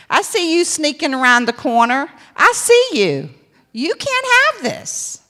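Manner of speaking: rising loud, defiant call-out to the devil; defiant preaching. Acted.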